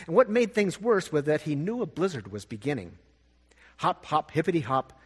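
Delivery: ordinary delivery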